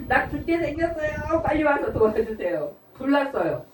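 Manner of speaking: mock desperate plea. Someone near death begging for quick help. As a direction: beg for help as if dying.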